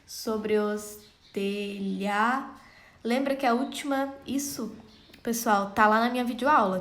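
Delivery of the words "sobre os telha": very slow, each syllable pulled apart. Shown as a demonstration. Teacher scanning a verse for students, very slow and broken. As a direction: spell out the beat of every syllable. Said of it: "Vamos fazer a contagem de sílaba".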